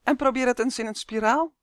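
high, loud mosquito voice; shrill. A narrator's reading. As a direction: pipe it out shrilly. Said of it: "snerpte hij".

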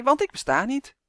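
high, mock-innocent teasing; grinning. A narrator's reading. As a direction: deny it with a sly grin. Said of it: "grijnsde hij".

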